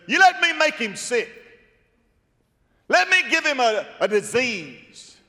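high, loud sly devil pleading; scheming devil, pitched high and loud; devil's voice. Acted. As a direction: voice the devil asking leave to strike. Devil voiced in Job story.

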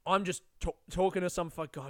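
ordinary talk, nothing marked

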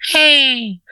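very high cat calling out; sudden high cat "hey"; high-pitched cat voice. Acted. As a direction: pipe up as an alarmed talking housecat. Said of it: "and he goes".

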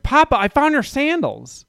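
put-on high, slow, eager toddler announcing a find; little girl's voice. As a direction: play the excited three-year-old. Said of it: "she was like".

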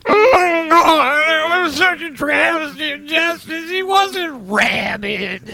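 slow sobbing wail of a bereaved wife; grieving otter widow. Acted. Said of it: "we actually do have his wife here in the studio".

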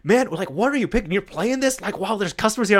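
a boss asking in disbelief what you're playing; boss scolding. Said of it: "but he was like".

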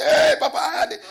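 mock the panicked member calling Papa; high laughing caricature of a frightened member; mocking a scared believer. Mimicking a frightened church member.

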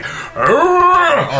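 high mock ape snarl; ape roar. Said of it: "and so they're like".

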